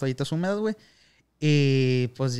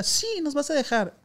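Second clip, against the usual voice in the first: put-on high girl's voice; 11 semitones higher than usual. Quoting the girl again.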